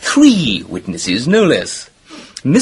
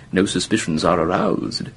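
normal talking voice

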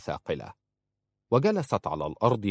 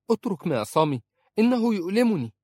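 high, pained plea, louder at the end; pleading; 11 semitones higher, 4 dB louder than usual. Acted. Princess begs her husband to release her wrist, high pained pleading. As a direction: beg him to let go of your wrist, it hurts. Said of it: "قالت في رجاء".

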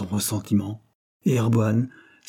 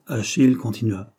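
ordinary delivery, nothing marked

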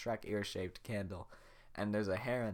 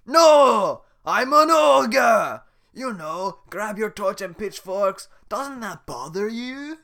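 ogre roar; 11 semitones higher, 20 dB louder, much slower than usual. Acted. Shrek roaring to scare Donkey.

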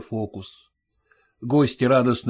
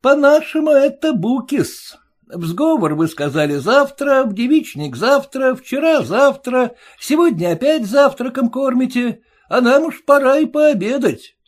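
a high, pinched voice, louder, needling the father-in-law; mocking groom; 11 semitones higher, 4 dB louder than usual. Groom taunting his stingy father-in-law, high and mocking. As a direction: jeer at him in a sing-song.

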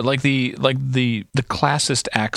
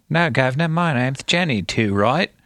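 exaggerated low-class British accent, pitched up; 4 semitones higher than usual. Imitating the girl correcting a stranger, played-up low-class British accent. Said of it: "low-class accent".

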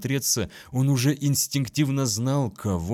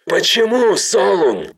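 anguished shout, much higher and louder; 11 semitones higher, 11 dB louder than usual. Acted. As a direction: cry out the name in despair. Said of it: "крикнул он".

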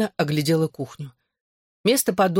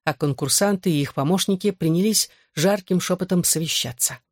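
ordinary delivery, nothing marked

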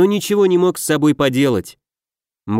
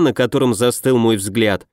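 plain reading voice

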